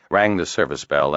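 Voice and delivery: usual speaking voice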